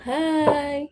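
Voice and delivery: ordinary delivery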